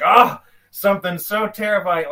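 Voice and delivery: lightly hammed-up hysterical outburst; mock raving madman. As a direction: imitate someone raving in terror. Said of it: "rolling in here raving mad".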